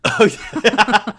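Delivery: ordinary talk, nothing marked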